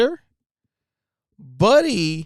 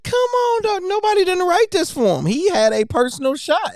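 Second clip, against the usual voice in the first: very high-pitched, excited disbelief; incredulous delight; 14 semitones higher, 3 dB louder than usual. Real, not acted. Fan reacting to the jab at Skip, high-pitched disbelief. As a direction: squeal it out in amused disbelief.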